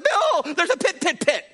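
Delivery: high, urgent yell of warning; warning Bill of a huge pit, yelling; frantic warning shout. Retold or imitated. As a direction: shout the warning three times, panicked. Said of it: "If I said".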